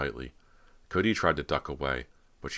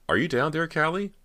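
high, loud questioning call; 14 semitones higher, 7 dB louder than usual. Acted. Girl at basement door seeking her sister, calling out. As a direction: call down, rising steeply on the name.

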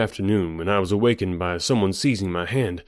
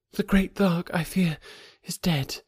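higher, softer, sorrowful; sad reply; 10 semitones higher, 4 dB quieter than usual. A narrator's reading. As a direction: break the news gently with grief. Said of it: "she replied sadly".

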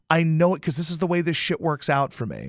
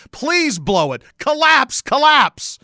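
high, loud pleading chant; mock pleading; 10 semitones higher, 6 dB louder, much slower than usual. Begging the Eagles to collapse, high and loud. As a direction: beg them to collapse, voice rising.

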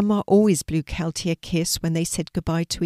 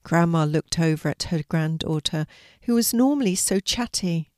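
usual speaking voice

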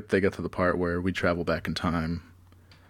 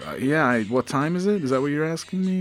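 baffled clerk; 7 semitones higher, 4 dB louder than usual. Acted. Confused clerk voiced.

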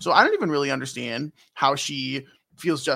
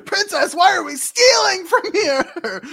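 high, slow, laughing mock knight's yell; hammed-up armored knight calling out through laughter; a much higher squawk, drawn out, breaking into laughs; Steiner yelling; 7 dB louder than usual. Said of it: "yelling "Princess" every five seconds".

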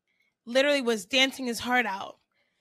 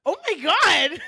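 high, loud laughing exclamation; shocked laughter; 6 semitones higher, 5 dB louder than usual. Real, not acted. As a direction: burst out laughing in disbelief. Shocked laughter at crude explanation.

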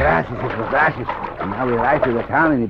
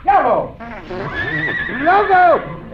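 calling out; slower than usual. Acted. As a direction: call the horse from afar. Pancho calling his horse.

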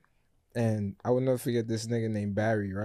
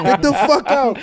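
very high, loud yell; furious teacher imitation; 12 semitones higher, 10 dB louder, slower than usual. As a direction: imitate a teacher throwing a student out. Retold substitute repeats the order.

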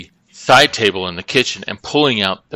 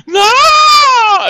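high, loud terrified no; panicked scream; 7 dB louder than usual. Retold or imitated. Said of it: "He was like".

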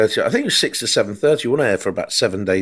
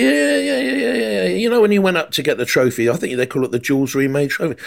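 high, excited yeah yeah; much higher, eager fan voice; eager caller; 7 semitones higher than usual. Retold or imitated. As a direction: gush like a starstruck fan.

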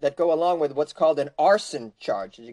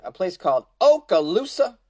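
usual speaking voice

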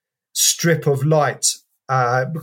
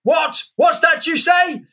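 bellowing shout. Retold or imitated. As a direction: bellow it at the top of your lungs. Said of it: "bellow at the top of his voice".

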